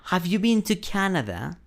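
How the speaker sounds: high-pitched, carefully intoned question on "Have you been to Canada"; question intonation. Shown as a demonstration. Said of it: "debes ponerle cierta entonación".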